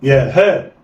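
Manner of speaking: يا ها snatched together; blended quickly. Shown as a demonstration. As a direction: run يا and ها into one. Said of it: "يلا، اخطف".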